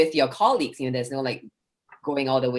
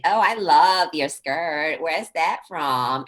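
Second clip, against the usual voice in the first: put-on slow, drawn-out gushing sorority girl; much slower than usual. Sorority girl admiring a skirt, drawn-out girly gush. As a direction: stretch every word like a ditzy admirer. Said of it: "I have a sorority girl voice".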